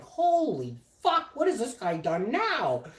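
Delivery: high, drawn-out, loud incredulity; imitated exasperated disbelief. Man on speakerphone hears about chef, high exasperated disbelief. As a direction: mimic someone aghast at the latest news.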